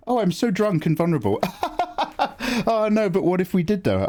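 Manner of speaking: giggling through the words; laughing. Real, not acted. Joking flirt with Rogue Shogun, laughing through it.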